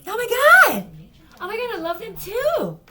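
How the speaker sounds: squealing very high and loud mock gush; mock a liar's overexcited squeal, laughing; voice going up. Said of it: "With the voice going up like that".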